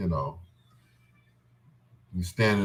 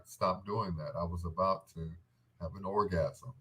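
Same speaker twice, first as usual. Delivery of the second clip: quiet complaint; 4 semitones lower, 10 dB quieter than usual. Retold or imitated. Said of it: "She's like".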